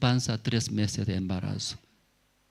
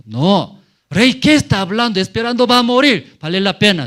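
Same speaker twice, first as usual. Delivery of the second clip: high, loud protest to the king; urgent arguing; 11 semitones higher, 12 dB louder than usual. Acted. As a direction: argue back at a near shout. Servant argues with the king.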